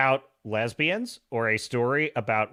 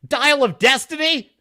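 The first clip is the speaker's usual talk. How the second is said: Dial of Destiny? loud and higher, scoffing; mocking disbelief; 9 semitones higher, 8 dB louder than usual. Shown as a demonstration. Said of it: "you know why I pronounce, like, Dial of Destiny".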